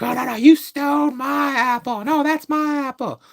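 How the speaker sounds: high, squeaky mock bickering over an apple; mock bum argument. Mimicking two bums bickering over food, in a high squeaky voice. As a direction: squabble like a silly bum. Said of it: "two bums get in an argument".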